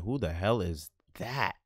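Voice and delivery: slow, drawn-out question. Acted. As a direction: stretch every word of it out. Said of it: "repeats, varying delivery".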